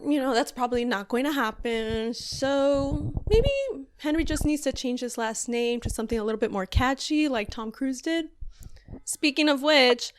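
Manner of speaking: usual speaking voice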